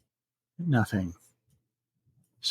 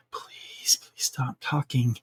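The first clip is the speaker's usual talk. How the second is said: hushed, desperate inner plea; whispered plea; 6 dB quieter, slower than usual. Retold or imitated. Frightened woman thinking as the man keeps talking, whispered plea. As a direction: whisper it, soft and drawn out.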